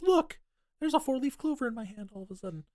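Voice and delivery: high fake delighted gasp; mock surprise. Host mocking the heroine's lucky find, high mock surprise. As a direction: play it as too-convenient wonder.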